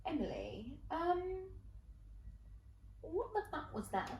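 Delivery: soft, hesitant question to a friend; hushed disbelief. Retold or imitated. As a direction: ask it quietly, stunned, with pauses. Friends stare, then question her.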